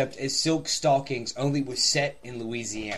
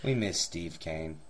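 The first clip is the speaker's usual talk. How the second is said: mock mournful; 9 semitones lower, 9 dB quieter, slower than usual.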